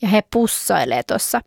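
ordinary delivery, nothing marked

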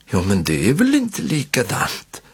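usual speaking voice

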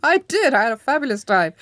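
high, gleeful confirmation; delighted agreement. Real, not acted. Guest agreeing with interviewer about a great time, delighted. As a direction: burst out with real delight, pitch leaping on the first words.

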